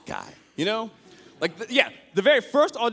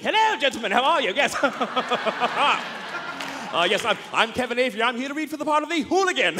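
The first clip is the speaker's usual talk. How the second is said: posh gentleman; 7 semitones higher than usual. Retold or imitated. Comic at a thug audition greeting the room, prim and proper. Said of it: "top hat and a cane and a monocle".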